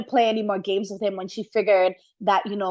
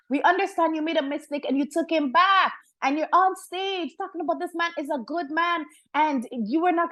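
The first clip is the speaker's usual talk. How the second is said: exasperated rant; 7 semitones higher than usual. Real, not acted. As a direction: plead with her to drop him. Ranting at Tamar to leave him.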